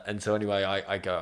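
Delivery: normal talking voice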